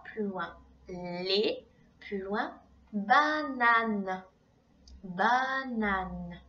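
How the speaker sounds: slow, spaced out words; slow dictation. Shown as a demonstration. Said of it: "Donc tu as écrit".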